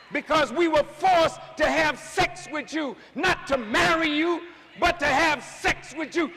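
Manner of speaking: high, loud preaching that builds to a shout; fiery preaching. Acted. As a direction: preach it hard, climbing toward a cry.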